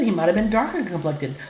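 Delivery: ordinary talk, nothing marked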